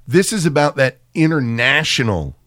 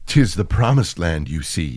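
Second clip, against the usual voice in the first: lower, softer mock-prophet voice; mock solemn voice; 8 semitones lower, 5 dB quieter than usual. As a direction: intone it low like a prophet. Joins the parody.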